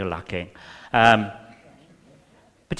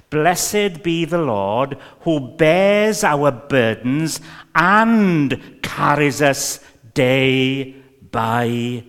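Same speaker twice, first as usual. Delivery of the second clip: very slow, louder solemn reading of the verse; slow preaching read; 6 dB louder, much slower than usual. Acted. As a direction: draw out each word like a proclamation. Reading literal translation slowly.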